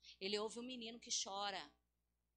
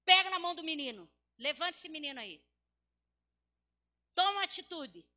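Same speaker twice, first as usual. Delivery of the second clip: loud, urgent preacher's command; forceful sermon exhortation; commanding angel; 6 semitones higher, 15 dB louder than usual. Acted. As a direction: order them to act, voice raised. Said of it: "ele pega e diz assim".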